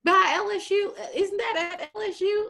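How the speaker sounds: high-pitched put-on puzzlement; mock clueless colleague. Colleague mistaking Southern for LSU, mock clueless.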